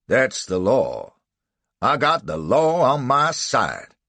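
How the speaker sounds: slow, loud defiant drawl; defiant slave catcher. A narrator's reading. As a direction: plant your feet and insist. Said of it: "Then Buck added defiantly".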